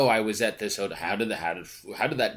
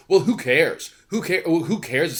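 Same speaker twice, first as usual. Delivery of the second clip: louder, higher mock "who cares"; hammed-up shrug of a voice; mocking dismissal; 7 semitones higher, 6 dB louder than usual. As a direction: sneer it like a dismissive pundit.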